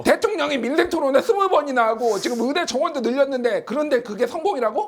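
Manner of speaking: high-pitched indignant tirade; play-arguing, ending in disbelief; acted incredulous quarrel. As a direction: pile up objections in mock outrage. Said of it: "이렇게 둘이 싸울 것이라는 거예요".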